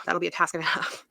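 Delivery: sigh, then a soft whisper. Real, not acted. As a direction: breathe it out, weary. Sighing at daunting task.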